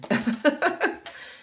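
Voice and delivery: a sudden high burst of laughter; laughing at her own bad English. Real, not acted. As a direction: laugh at yourself, high and bright.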